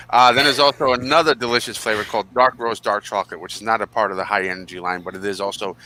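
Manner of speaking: normal talking voice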